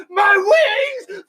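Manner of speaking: high, drawn-out mock wail; mock crying scream. Mocking Drayton losing his wings to the dogs, tearful scream. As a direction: scream it like a heartbroken overgrown kid. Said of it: "He's crying, screaming".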